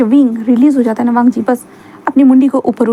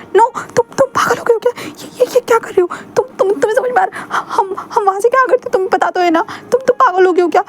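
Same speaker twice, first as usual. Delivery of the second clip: much higher, breaking into sobs, pleading; tearful panicked protest; 9 semitones higher than usual. Acted. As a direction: cry out in shocked disgust, voice cracking. Wing protests, crying.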